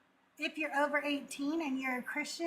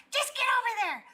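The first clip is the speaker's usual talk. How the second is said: imitating her dad; 4 semitones higher than usual. Mimicking her dad cursing the kids before a family photo, mock-angry and higher. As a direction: ham up the irritated father swearing at his kids. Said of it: "I always like to talk like my dad".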